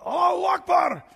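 high, loud ringing cry of Allahu Akbar; retold shout. Man on the Temple Mount suddenly calling out, loud shout. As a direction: call it out across the square. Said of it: "one guy yells out".

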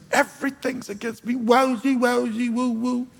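hammed-up whiny lament; cartoon woe-is-me whine. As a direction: moan it like a sad cartoon character. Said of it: "you know what that's a reference to".

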